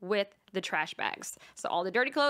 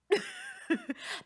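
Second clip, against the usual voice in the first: high laugh of disbelief; short high giggle; high breathy laugh. Real, not acted. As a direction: laugh at your own mess. Dripping tan discovered.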